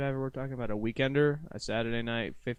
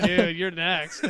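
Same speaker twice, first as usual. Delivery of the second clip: slow, louder teasing warning; mock warning; 6 semitones higher, 7 dB louder, much slower than usual. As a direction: drag it out to tease a friend. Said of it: "and like".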